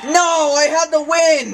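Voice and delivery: outraged shout. Real, not acted. Losing a race, outraged.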